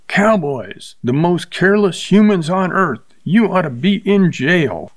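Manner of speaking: a high, outraged scream at the cowboy; woman screams at careless cowboy, outraged. A narrator's reading. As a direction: shriek it out, scandalized. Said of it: "She looked at him and screamed".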